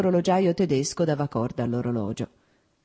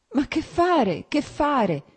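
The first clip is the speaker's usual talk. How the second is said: pitiful voice; 11 semitones higher, much slower than usual. A narrator's reading. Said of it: "con voce pietosa".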